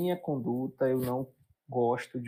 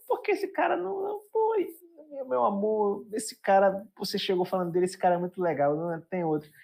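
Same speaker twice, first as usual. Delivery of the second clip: higher, mock Bahian wife pleading; wife's Bahian voice pitched up, teasing her husband; 9 semitones higher than usual. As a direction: play the wife in a Bahian accent, lifting the pitch. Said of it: "eu tô imitando o sotaque deles".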